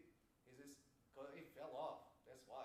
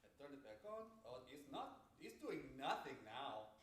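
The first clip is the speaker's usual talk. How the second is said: ordinary talk, nothing marked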